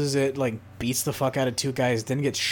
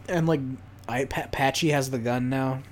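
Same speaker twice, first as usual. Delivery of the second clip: ordinary talk, nothing marked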